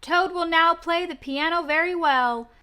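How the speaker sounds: high, loud and slow cheer; cheering shout. Acted. Frog shouting hooray to Toad in the dream. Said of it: "as he shouted".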